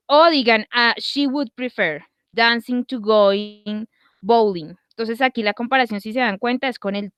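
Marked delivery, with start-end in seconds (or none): 1.0-4.7 slow, careful English example; slow model sentence. Shown as a demonstration. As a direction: read it slowly for learners. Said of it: "teacher models example slowly".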